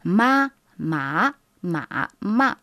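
"Ma, ma, ma, ma" run through the four tones in order; teacher chants the four tones as a practice technique; four-tone drill. Shown as a demonstration. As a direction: drill the tones quickly in sequence. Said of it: "c'est une technique que nous".

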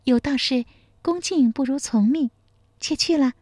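put-on high young wife's voice, smiling as she agrees; sweet smiling wife. A narrator's reading. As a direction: answer with a graceful smile in a high voice. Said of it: "贺金蝉嫣然一笑".

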